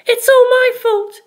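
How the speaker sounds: put-on shy, apologetic girl; Penelope's voice. A narrator's reading.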